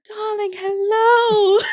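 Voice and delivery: very high, loud, slow stretched theatrical greeting; delighted old mother. Retold or imitated. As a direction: greet her like a long-lost darling, drawn out and high. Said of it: "then she'd be like".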